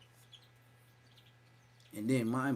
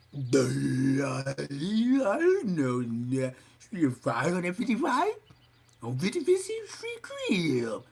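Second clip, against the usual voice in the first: mock [name]; 2 semitones higher, 5 dB louder, slower than usual. Mocking [name]'s voice.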